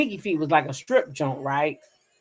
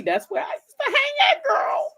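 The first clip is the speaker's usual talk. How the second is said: excited shout-out. Real, not acted. Shouting out her old neighborhood.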